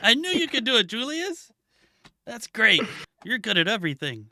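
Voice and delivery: high, excited praise; cheering voice. Acted. Player cheering Julius on after the match, high and excited.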